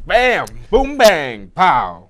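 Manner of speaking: loud, punchy shouts; excited sound effects. Acted. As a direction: belt out bam, boom, bang, pow. Mimics a burst of shots.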